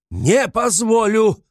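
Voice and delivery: loud, high, drawn-out cry; furious shout. A narrator's reading. Raskolnikov shouting his refusal a second time, furious shout. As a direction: shout the refusal at full pitch. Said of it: "крикнул было другой раз Раскольников".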